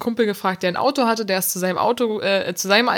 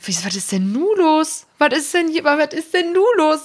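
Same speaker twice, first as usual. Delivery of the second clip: verwundert; 7 semitones higher, 3 dB louder than usual. Retold or imitated. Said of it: "Aber ich hab gedacht".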